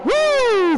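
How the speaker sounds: high, drawn-out "woo"; excited whoop. Real, not acted. Race commentator cheering the cars on, excited whoop.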